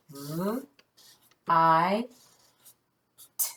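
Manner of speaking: "V, I, T" as three isolated sounds, long pauses; sounding out. Shown as a demonstration. Teacher writing the word sound by sound, sounding out. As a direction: give "V, I, T" one sound at a time. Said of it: "Tap those sounds".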